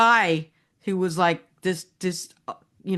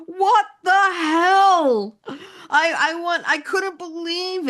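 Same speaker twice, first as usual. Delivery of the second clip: much higher, slow, stunned; can't believe they ate groundhogs; shocked disbelief; 9 semitones higher, 4 dB louder, slower than usual. Real, not acted. Shocked at the quiz answer.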